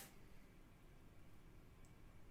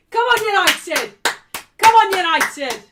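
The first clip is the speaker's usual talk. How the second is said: loud, high "come on"; cheering; 6 semitones higher, 12 dB louder than usual. Real, not acted. Fan cheering United on during the match. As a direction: urge the team on at full voice.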